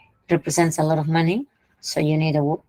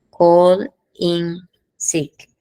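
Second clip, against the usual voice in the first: slow, clear model phrase; 4 dB louder, much slower than usual. Shown as a demonstration. Teacher modelling the idiom for students, very slow and deliberate. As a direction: Call in sick stretched out slowly, each word separate and a bit louder. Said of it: "Mira cómo ha usado esta frase".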